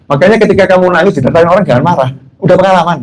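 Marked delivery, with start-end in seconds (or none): none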